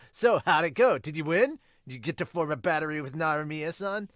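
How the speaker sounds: put-on high, loud eager boy voice; loud cheerful kid bursting into the room; excitable Sawamura. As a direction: burst in bright and keen as the hyper rookie. Sawamura questions Miyuki eagerly.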